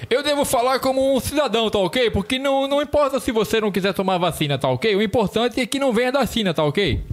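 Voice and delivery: high nasal voice with a slushy lisp; lisping nasal Bolsonaro. Retold or imitated. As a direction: mimic a lisping, nasal politician. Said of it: "um Bolsonaro com a língua presa e fanho".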